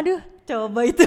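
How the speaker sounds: loud, amused objection; laughing protest. Real, not acted. Teased about sacrificing boyfriend. (protes sambil tertawa)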